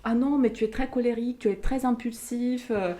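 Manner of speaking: scolding grandmother. Retold or imitated. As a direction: voice grandma scolding an impulsive child. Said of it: "ma grand-mère me disait".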